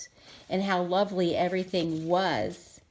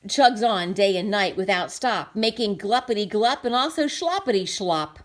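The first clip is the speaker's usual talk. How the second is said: lively read-aloud narration; picture book narration; story reading; 5 dB louder than usual. A narrator's reading. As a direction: read it to the kids with bounce.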